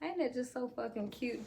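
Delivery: ordinary talk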